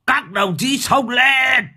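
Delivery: high, drawn-out rallying cry; heroic battle shout. A narrator's reading. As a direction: shout the charge like a boastful hero. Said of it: "giơ cao kiếm hô to". (hô xung phong dõng dạc, kéo dài)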